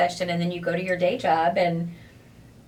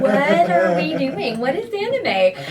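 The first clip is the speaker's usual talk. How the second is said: excited; 6 semitones higher, 7 dB louder than usual. Retold or imitated. Retold panicked newcomer quote.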